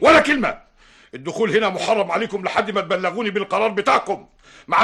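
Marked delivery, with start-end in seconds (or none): 0.0-0.6 loud, high snapped command; angry shout. Acted. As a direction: cut them off with a shout. Silences them angrily.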